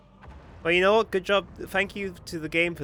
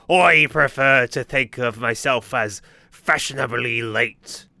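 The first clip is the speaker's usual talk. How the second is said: slow, louder hammed-up pirate; pirate impression; 6 dB louder, slower than usual. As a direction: strut through it like a vain pirate. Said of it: "He do be talking like that".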